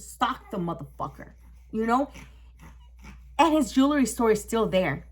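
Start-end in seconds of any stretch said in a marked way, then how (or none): none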